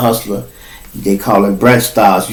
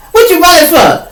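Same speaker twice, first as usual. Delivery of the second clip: calling out; 4 dB louder than usual. Retold or imitated. Inmate calling after narrator.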